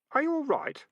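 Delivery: put-on a concerned boy; boy's voice. A narrator's reading. Keith asks the rat.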